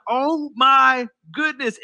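slow, drawn-out amazement; amazed. Retold or imitated. Peter finding that walking on water works, drawn-out amazement. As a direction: stretch it out in wonder.

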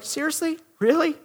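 soft, high disbelief; quietly stunned at the rudeness; incredulous. Retold or imitated. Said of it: "you look at that person like".

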